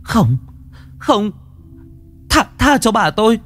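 high desperate scream; screaming girl. A narrator's reading. Xuân screaming at the monster to spare her grandmother. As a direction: beg the monster at the top of her voice. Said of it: "Xuân gào lên".

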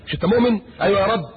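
ordinary talk

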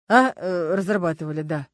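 hesitant, stalling answer with fillers; evasive. A narrator's reading. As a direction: dodge the question, stumbling into a yes. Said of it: "сказал он уклончиво". (уклончиво, с запинкой)

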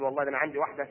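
low, soft, quick murmur of a grateful husband; lightly voiced husband, talking it over under his breath; husband marvelling at his wife, hushed and quick; husband musing to himself. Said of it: "تقول".

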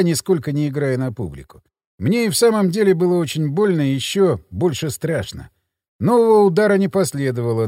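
usual speaking voice

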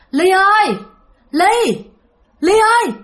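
high calling from a distance. Retold or imitated. A ghost calling Ly from outside the gate, loud call. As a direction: call her name out from the street.

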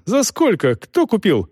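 high-pitched, urgent questions; agitated. A narrator's reading. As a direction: demand answers in rising alarm. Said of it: "Он заволновался".

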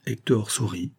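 ordinary delivery

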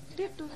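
high breathy echo of the signal; echoed code sound. Acted. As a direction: squeak it back quietly. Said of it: "Aku jawabnya".

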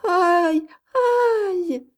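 put-on high-pitched wailing cry of a child; terrified child's sob. A narrator's reading. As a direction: wail like a frightened little girl. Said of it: "sanglota l'enfant terrifié". (sanglot d'enfant terrifié)

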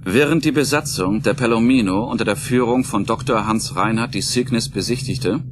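ordinary talk

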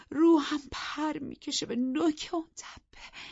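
high, loud bitter contempt; angry. Acted. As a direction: spit scorn at your husband. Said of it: "با خشم گفت".

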